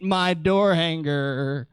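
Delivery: drawn-out, dropping sulk; mock pout. Preacher acting a child denied the paint colour, mock sulky. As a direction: let it sag slowly into a pout.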